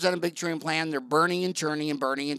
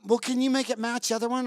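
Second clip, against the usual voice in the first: hopeful patient; 6 semitones higher, faster than usual. Retold or imitated. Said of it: "she'll say".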